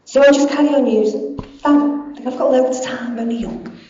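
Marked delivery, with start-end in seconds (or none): none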